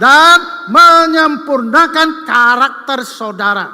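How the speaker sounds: a pastor pressing humility on the church, in forceful preaching; full-force preaching. Acted. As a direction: preach it hard, slow on the key words, to the congregation.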